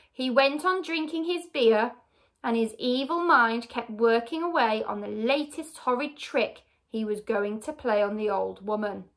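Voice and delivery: usual speaking voice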